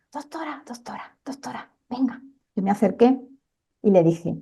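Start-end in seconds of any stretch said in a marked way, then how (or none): 0.1-2.2 faint breathless whisper of a dying man. Retold or imitated. Dying patient calling the doctor over in a faint whisper. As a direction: barely breathe the words out. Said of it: "en un susurro".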